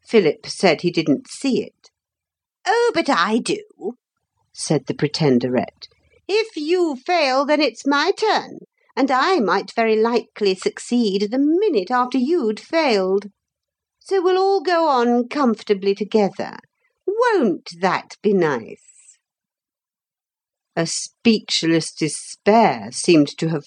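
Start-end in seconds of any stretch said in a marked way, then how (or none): 2.6-3.9 high, smug contradiction; hateful lady. A narrator's reading. Pretenderette contradicting Philip, high and hateful. 6.3-19.2 high false-sweet lady's voice; hateful, gloating lady. A narrator's reading. Pretenderette inviting herself along, high gloating sweetness.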